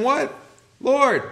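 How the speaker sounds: mock panicked cry. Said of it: "they cry out to him".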